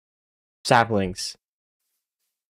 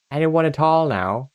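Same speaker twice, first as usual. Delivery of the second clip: usual speaking voice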